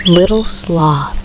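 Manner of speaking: plain reading voice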